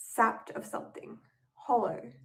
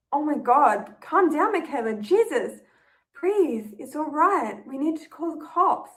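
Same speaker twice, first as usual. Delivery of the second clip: higher, louder, urgent reassurance; urgent calming shout; 4 semitones higher, 6 dB louder than usual. Acted. Ella calling to a panicking Michaela, urgent loud reassurance. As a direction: cut through her panic, loud and urgent.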